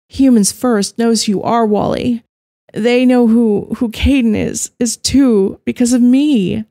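high, loud, anguished confession; distressed. A narrator's reading. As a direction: voice strained with guilt and fear.

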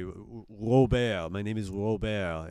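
slow put-on French accent; French character. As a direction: introduce yourself as a slow-talking Frenchman. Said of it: "like, a French character".

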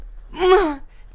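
mock aggressive throat clear; imitated grunt. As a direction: hock up a wind-up grunt before a punch. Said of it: "makes that noise".

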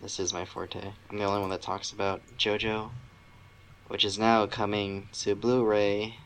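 normal talking voice

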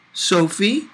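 put-on light high child's voice, questioning; lightly voiced boy calling to Sophie from the shower; little boy asking. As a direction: ask like a small curious kid. Little boy asks again.

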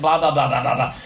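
meaningless run of blahs; droning teacher. Acted. Kids tuning out the teacher, rattled-off mock droning. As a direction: mimic a teacher's empty noise. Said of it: "It's just like the teacher said".

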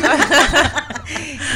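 loud, high laughing fit; hearty laughter. Real, not acted. Guest laughing with the host before answering. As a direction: let the laugh roll out.

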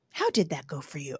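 low, soft voice of God; the Lord's gentle rebuke. Retold or imitated. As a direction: ask it quietly, as the Lord would. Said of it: "the Lord is just like".

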